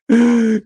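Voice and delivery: short loud high laugh; a real laugh, high and loud; burst of laughter. Real, not acted. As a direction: crack up at the bit. Laughs at his own impression.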